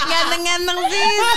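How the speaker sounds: high, slow laughing echo; laughing repeat. Real, not acted.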